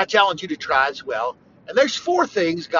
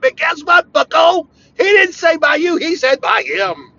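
raised, higher and louder shout; loud rebuke; 9 semitones higher, 7 dB louder than usual. Real, not acted. Preacher shouting down theologians who claim the only way. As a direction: hit them hard with the correction.

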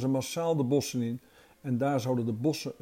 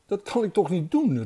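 higher, louder outburst of protest; exclaiming; 6 semitones higher, 5 dB louder than usual. A narrator's reading. Quintus protests to the deer about shutting down all robots, exclaiming. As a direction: cry out in mild dismay. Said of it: "riep hij na een poosje uit".

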